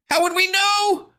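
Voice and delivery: put-on high, loud, slow boyish shout; panicked shout. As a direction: yell it from below. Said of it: "shouted from the basement".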